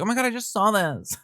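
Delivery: squeaky high gasp of mock surprise; high mock Taylor. Imitating Taylor reading a text, high and surprised. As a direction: pitch it way up like a starstruck fan. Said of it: "But she's like".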